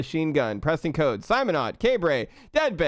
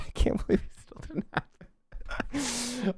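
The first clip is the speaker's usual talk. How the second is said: soft, slow laugh through words; laughing disbelief; 9 semitones higher, 7 dB quieter, slower than usual. Real, not acted. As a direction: laugh while saying it.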